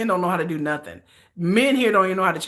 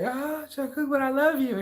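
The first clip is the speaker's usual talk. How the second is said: higher, half-laughing plea; mock pleading; 8 semitones higher than usual. A fan begging [name] to stay, half-laughing plea. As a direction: beg him playfully not to leave. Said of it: "You just be like".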